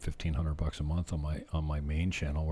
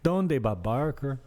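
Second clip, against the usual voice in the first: slow, high, louder mock Spanish inflection; local Spanish inflection; 6 semitones higher, 5 dB louder, slower than usual. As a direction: belt out a playful Spanish-inflected question. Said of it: "adopt the local inflection".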